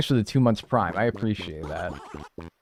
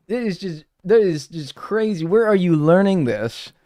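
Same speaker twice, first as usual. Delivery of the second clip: shocked yelling; 7 semitones higher, 4 dB louder than usual. Real, not acted. Shocked outburst at chat prank.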